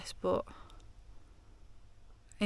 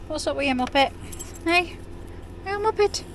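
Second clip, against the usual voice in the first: pet talk to dog; 8 semitones higher, 6 dB louder than usual. Real, not acted. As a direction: coo up high at the puppy. Talking to her dog.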